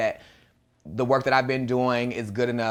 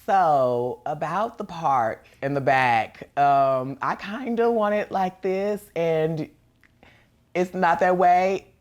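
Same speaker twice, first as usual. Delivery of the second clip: sassy drag queen; 5 semitones higher, 4 dB louder, slower than usual. Retold or imitated. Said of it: "and I'm like".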